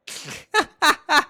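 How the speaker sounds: loud high laughing burst; burst of laughter. Real, not acted. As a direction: crack up loudly. Laughing at Jack.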